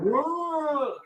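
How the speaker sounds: high startled whoa; mock whoa. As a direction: imitate the daughter's shocked whoa. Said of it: "She was like".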